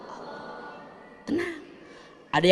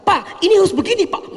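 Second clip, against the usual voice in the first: high, loud pushy pleading to the boss; hammed-up impatient wife; mock insistent demand; 13 semitones higher, 4 dB louder, faster than usual. Wife pushing boss with proposal.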